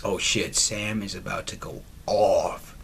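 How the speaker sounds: low, soft near-whisper; hushed anticipation. Real, not acted. Player warning that Sam will go off, hushed. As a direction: drop to a hush before the blow-up. Dreading Sam's reaction in game.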